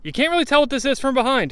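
usual speaking voice

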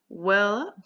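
bright loud cheerful greeting. A narrator's reading. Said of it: "Two-Bit said cheerfully".

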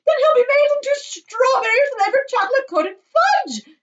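high, very loud horrified shriek; screaming. A narrator's reading. Mother fears son becomes fudge, screaming. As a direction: scream it in horror. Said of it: "screamed Missus Gloop".

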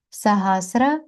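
normal talking voice

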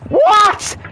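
high shout that cracks mid-word; voice cracking shout. Real, not acted. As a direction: yell in disbelief and let the voice break. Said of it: "Ah, voice crack".

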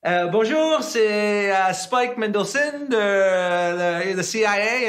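put-on a young American trying his French on the phone; hammed-up self in shaky French; nervous French attempt. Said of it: "I'd be like".

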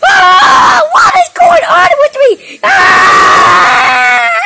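very high, loud frightened cry; terrified shouting; panicked screaming. Acted. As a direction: scream waking from nightmare. Waking up screaming.

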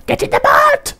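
sudden high, strained cry; high-pitched yell. Acted. Gamer urging his character into the boat, high-pitched yell. Yelling at the game.

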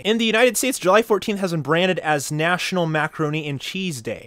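usual speaking voice

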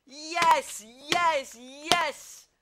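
loud, high yes yes yes; cheering goal call. Pretend. Commentator cheering another goal.

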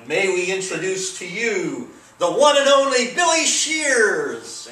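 dramatic announcement. Acted. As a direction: introduce the star like an emcee. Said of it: "done for dramatic effect".

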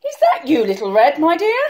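put-on high, loud old granny; high granny voice, questioning; granny calling. A narrator's reading. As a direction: call through the door as Granny. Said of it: "called Granny".

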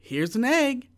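high loud shout. A narrator's reading. Jack to his mother with the egg, a high loud shout. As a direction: call it out proudly. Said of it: "shouted Jack".

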